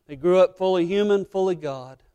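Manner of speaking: preaching at full force. Acted. Pastor proclaiming to the church, loud.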